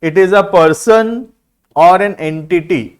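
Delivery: reads each word very slowly and clearly; slow dictation. Shown as a demonstration. Teacher reading a definition to students, slow dictation. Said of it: "देखना क्या लिखा है".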